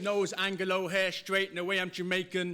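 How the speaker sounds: high, projected rhythmic recital; spoken-word performance. Acted. Poet leading a crowd's call and response, high declaiming. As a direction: declaim it in punchy lines, leaving room for responses.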